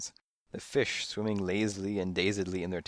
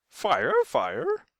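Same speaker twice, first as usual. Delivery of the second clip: high, loud parrot shriek; dramatic shriek; 10 semitones higher, 8 dB louder than usual. A narrator's reading. Parrots echo the word as an alarm, dramatic shriek. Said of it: "they shrieked dramatically".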